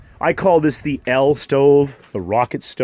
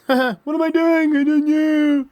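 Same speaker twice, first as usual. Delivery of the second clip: squeaky cartoon voice; silly high voice. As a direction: pipe it up high and goofy.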